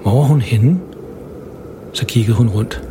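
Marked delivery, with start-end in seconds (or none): none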